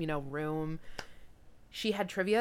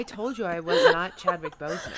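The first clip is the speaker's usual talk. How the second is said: louder, higher put-on voice, laughing through it; a hammed-up fed-up guy insisting he warned you; mock indignant stranger; 5 semitones higher, 5 dB louder than usual. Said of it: "And he was like".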